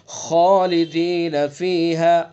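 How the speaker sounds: slow "خالدین" with a thick kha; slow tajweed model; heavy kha. Shown as a demonstration. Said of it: "خا کو پر پڑھیں گے".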